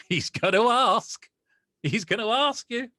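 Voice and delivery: a giddy inner voice breaking into a laugh; excited. Retold or imitated. His own mind sensing the film offer coming, laughing excitedly.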